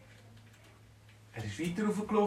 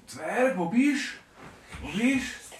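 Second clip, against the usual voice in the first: calling out; 7 semitones higher, 4 dB louder, slower than usual. Acted. Uncle calls for dwarf.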